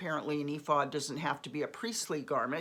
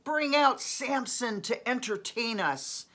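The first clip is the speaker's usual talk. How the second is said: raised, drawn-out crowd call; mild narrated shout; 7 semitones higher, 4 dB louder, slower than usual. A narrator's reading. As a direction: call it out slowly, pitched up, without yelling. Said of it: "they shouted".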